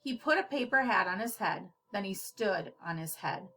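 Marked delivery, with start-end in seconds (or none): none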